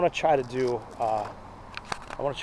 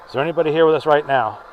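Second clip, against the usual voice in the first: calling out; 5 dB louder than usual. Real, not acted. Calling out to spirits in crypt.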